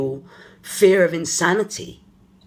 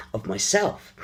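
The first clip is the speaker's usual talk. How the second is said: usual speaking voice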